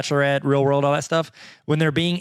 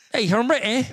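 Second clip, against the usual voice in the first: высокий пародийный британский акцент; mock British accent; 7 semitones higher than usual. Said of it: "You didn't say any consonants".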